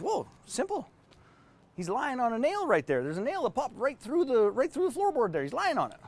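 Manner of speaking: high, put-on old gentleman voice. Dog's owner explaining the nail to his visitor, high character voice. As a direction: play the unbothered owner explaining, pitched well up. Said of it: "And his friend says".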